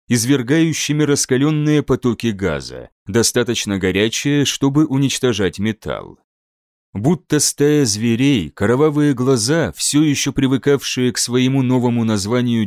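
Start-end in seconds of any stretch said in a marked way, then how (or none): none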